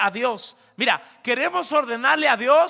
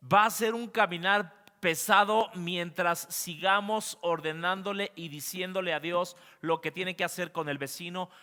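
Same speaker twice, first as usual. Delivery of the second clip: ordinary talk, nothing marked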